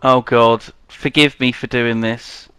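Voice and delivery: ordinary talk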